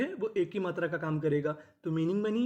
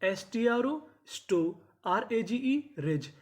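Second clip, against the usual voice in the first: slow spelling out; 3 semitones higher, much slower than usual. Shown as a demonstration. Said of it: "teacher spells out letter groups".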